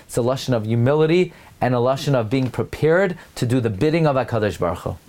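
normal talking voice

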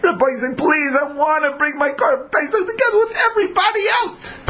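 high, begging plea of an eager supplicant; pleading. Retold or imitated. Impure man begging the Rabbi to bring his offering, high pleading. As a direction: beg the Rabbi to let you join. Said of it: "he's pleading".

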